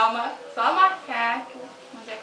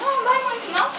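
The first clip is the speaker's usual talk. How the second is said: aguda, nervosa; 11 semitones higher, 5 dB louder than usual. Real, not acted. Garota reage à dor do furo.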